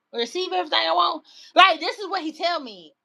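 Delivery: normal talking voice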